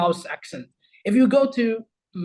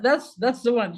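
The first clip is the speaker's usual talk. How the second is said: normal talking voice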